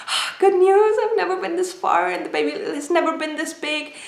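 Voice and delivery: high-pitched delighted exclamation, easing off; retold excitement. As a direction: re-enact thrilled relief, starting high and strong. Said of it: "I was so excited. I was like".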